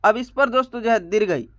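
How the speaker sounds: normal talking voice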